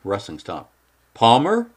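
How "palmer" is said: high, loud worried call. Acted. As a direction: shout down the hill. Calling down slope.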